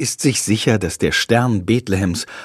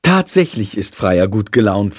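usual speaking voice

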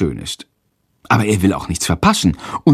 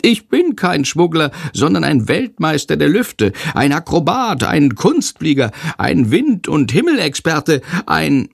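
high little bat voice, boasting; proud bat; 6 semitones higher than usual. A narrator's reading. Little bat Sándor boasting to his friend about flying. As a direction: puff up like a tiny show-off flyer.

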